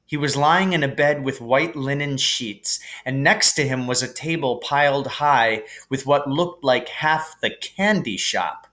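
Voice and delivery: ordinary delivery, nothing marked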